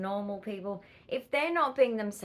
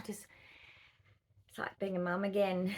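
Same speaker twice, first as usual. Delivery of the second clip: ordinary talk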